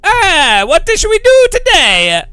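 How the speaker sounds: high, loud, hammed-up Italian accent; Marco, Italian accent. Streamer mocks a drunk game designer, hammed-up Italian accent. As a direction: exuberant cartoon Italian, stretching the words out. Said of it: "Kunos design is Marco going".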